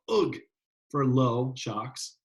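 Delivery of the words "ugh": short high yelp of pain, imitated; mock cry of a shocked man. Shocked learner yelping through the wall, a high pained ugh. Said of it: "the learner would yell".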